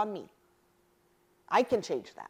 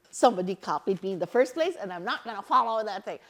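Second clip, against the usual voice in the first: fast, louder huffy retelling of her words; imitating angry friend; 3 semitones higher, 8 dB louder, faster than usual. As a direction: play back her indignant rant. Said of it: "She was so pissed".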